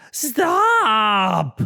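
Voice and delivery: sudden loud stop; alarmed cry. Acted. Rabbit stopping the porcupine from flushing blocks, loud.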